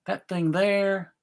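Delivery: ordinary talk, nothing marked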